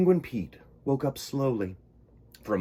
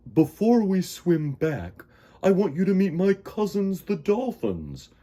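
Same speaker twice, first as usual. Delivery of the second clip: friendly whale voice; 8 semitones higher, 6 dB louder than usual. Acted. Walter speaking.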